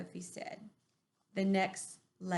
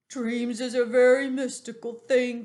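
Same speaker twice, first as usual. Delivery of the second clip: giant's voice; 7 dB louder than usual. Acted. The BFG explains dreams.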